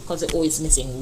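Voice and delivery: ordinary delivery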